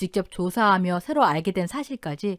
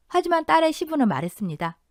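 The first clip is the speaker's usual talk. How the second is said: usual speaking voice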